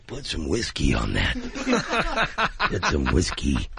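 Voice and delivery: very low growled caricature; Gary Busey. Acted. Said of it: "Gary Busey had Subway sandwich".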